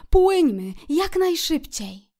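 high, excited urging; eager exclamation. A narrator's reading. Kret urges Szczur to set off, eager exclamation. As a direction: burst out eagerly, high and loud. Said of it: "wykrzyknął".

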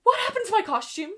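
loud, high, fast distressed cry; upset shout. Acted. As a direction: wail it out in dismay. Witch upset over ruined costume.

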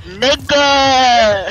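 put-on loud, drawn-out indignant kid; mimicked outcry. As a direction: imitate a kid's stretched-out yell.

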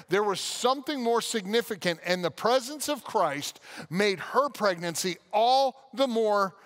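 ordinary talk

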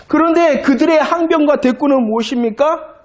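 ordinary talk

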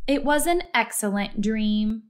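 ordinary delivery, nothing marked

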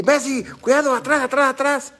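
high, urgent mock sideline call; imitated fan yelling. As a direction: mimic a fan calling warnings to a player. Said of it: "le estás gritando a Messi".

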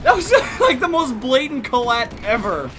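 high, slow words through laughter; laughing disbelief. Real, not acted. Laughing at a lucky shot.

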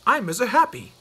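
cheerful hello. Acted. Mister Happy introduces himself.

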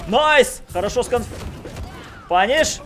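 high, loud hype calls; excited shouting. Real, not acted. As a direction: yell it out as the match swings. Commentator hyped by the play.

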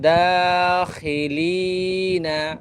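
দাখিলিনা drawn out long on the vowel; elongated madd. Shown as a demonstration. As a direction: stretch দাখিলিনা one alif. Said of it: "মদগুলা কীভাবে এক আলিফ লম্বা করে পড়তেছি". (দাখিলিনা মদ টেনে পড়া)

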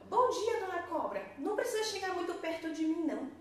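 high, quick fussy hen. A narrator's reading. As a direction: cluck it out high and hurried. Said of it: "a Dona Galinha falava".

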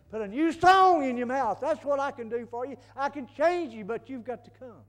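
very loud, high, impassioned pulpit cry; full-force preaching. Real, not acted. Preacher voices God offering a new song, full-force preaching. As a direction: belt out the promise to the congregation.

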